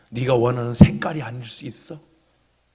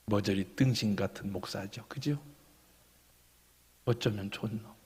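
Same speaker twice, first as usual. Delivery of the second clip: self-mocking mutter; 4 semitones lower, 13 dB quieter than usual. Real, not acted. Pastor mocking himself.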